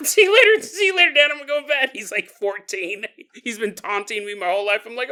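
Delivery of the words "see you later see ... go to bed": high, loud, hurried-off goodbye; comic high-pitched alarm; mock panicked exit. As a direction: play spooked and bailing out, pitched way up. Acts out fleeing the spooky child.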